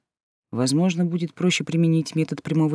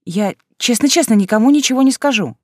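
higher, louder eager pleading; pleading promise; 6 semitones higher, 5 dB louder than usual. A narrator's reading. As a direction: beg earnestly like a child. Heroine begs to go home.

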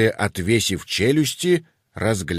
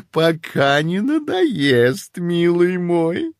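slow, fond teasing mother; drawn-out affectionate tease; 7 semitones higher, much slower than usual. Acted. Mother dragon answers son. (неспешно и ласково-насмешливо)

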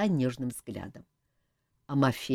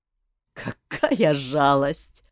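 slow mock pity through laughter; laughing out loud; 2 semitones lower, 4 dB louder, much slower than usual. A narrator's reading. King laughs at the jester's tale of the elf, slow mock pity. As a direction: savour the fake sympathy, laughing. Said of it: "расхохотался король".

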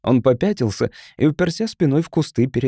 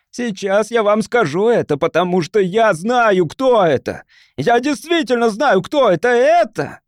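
high, insistent, feverish declaration; frantic announcing; 8 semitones higher, 3 dB louder than usual. A narrator's reading. Cornered man promising the crowd a culprit, frantic. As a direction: stall with mounting, frantic insistence.

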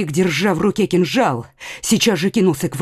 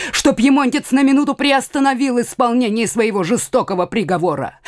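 ordinary delivery

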